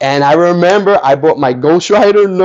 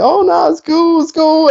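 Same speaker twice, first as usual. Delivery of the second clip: squeaky, much higher mock kid voice, reassuring; embarrassed kid brushing it off. Kid tells comic-booth girl it's fine, high embarrassed squeak. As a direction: retell it in a high flustered squeak. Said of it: "I was like".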